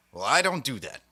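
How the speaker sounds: usual speaking voice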